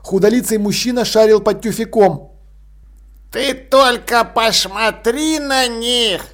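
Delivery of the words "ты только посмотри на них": put-on slow, snorting sarcastic old man. A narrator's reading. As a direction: scoff at them like a bitter old man. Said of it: "язвительно фыркнул старик".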